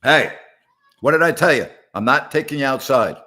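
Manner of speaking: sharp loud scold at a pet; loud telling-off of a misbehaving cat. Real, not acted. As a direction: snap at the cat to stop.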